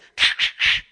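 loud voiceless clunking noises; imitated lock noise. Speaker shows the tour what was heard at the cell, imitated lock noise. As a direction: make a harsh, loud noise of a lock turning. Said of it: "all you hear is".

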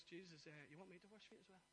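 a hint of a humble disciple; quiet disciple. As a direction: speak it meekly to Jesus. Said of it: "The disciple says".

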